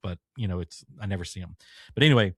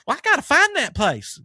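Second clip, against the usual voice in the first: Lady Boomhauer; 7 dB louder than usual. Retold or imitated. Customer demands a piano in a high, loud Boomhauer voice. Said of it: "she sounds just like".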